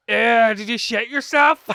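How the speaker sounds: loud, high Belfast jeer; Belfast accent. Retold or imitated. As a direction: shout it in a broad Belfast accent. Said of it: "we'd be like".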